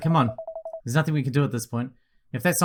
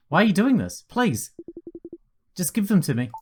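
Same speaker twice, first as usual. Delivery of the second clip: higher, pleading for the pills; desperate begging; 7 semitones higher than usual. Acted. Ray begs Liza to hand over the pills, desperate. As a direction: beg her, voice climbing high.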